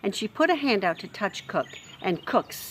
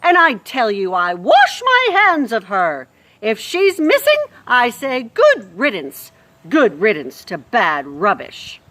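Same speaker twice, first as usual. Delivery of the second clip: loud, higher scornful shout; loud contemptuous rant; angry shouting; 4 semitones higher, 10 dB louder than usual. Acted. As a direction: bellow it, washing your hands of her. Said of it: "Louise was shouting".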